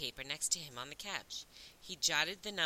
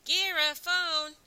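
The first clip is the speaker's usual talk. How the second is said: loud, high, sustained holler; shout; 11 semitones higher, 9 dB louder, much slower than usual. A narrator's reading. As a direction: call a colleague to the phone at full volume. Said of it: "the guy shouted".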